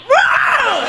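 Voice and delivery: drawn-out, hammed-up shouted whoa; whooping yell, swooping up and down; mock wild whoa. Comic imitating a patient on pills, mock wild yell. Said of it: "But you can be like".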